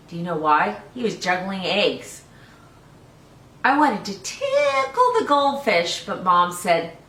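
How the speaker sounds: put-on lively read-aloud for small children; storybook reading. Teacher reading a picture book to children, lively.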